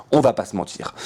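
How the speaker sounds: ordinary talk, nothing marked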